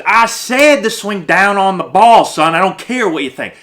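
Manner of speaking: mock-angry coach, loud and high; stubborn coach. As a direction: bellow it like a coach shutting down a player. Acted stubborn coach overruling player.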